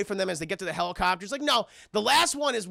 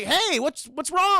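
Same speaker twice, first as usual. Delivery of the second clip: higher, louder mock concern; 6 semitones higher, 5 dB louder, slower than usual. Charlie Brown asking Roland what's wrong, high mock concern. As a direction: ask with a clueless boss's cheer. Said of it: "Charlie Brown's like".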